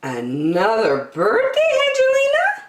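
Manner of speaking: put-on high, teasing old lady voice with a sudden leap up on the name; amused shopkeeper. Shopkeeper teasing Angelina about her birthday, amused high voice. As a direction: playfully tease the young customer. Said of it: "Missus Thimble chuckled".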